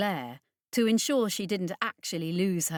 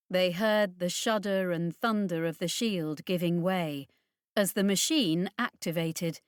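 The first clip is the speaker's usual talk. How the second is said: ordinary delivery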